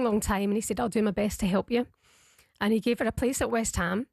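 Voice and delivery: usual speaking voice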